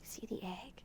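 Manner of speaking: whisper. Acted. Hushed aside during story.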